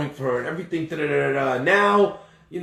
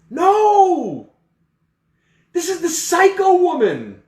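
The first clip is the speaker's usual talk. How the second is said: high, loud, slow moral outrage; preacher condemning jealousy at full force; preaching at full force; 12 semitones higher, 8 dB louder, slower than usual. Real, not acted. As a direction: thunder it from the pulpit.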